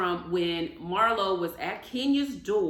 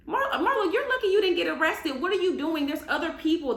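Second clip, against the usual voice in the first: hyped up; 7 semitones higher than usual. Real, not acted. Vlogger scolding Marlo over the hotel scene, hyped up. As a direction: get heated at her, pitch climbing. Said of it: "I'm getting hyped up".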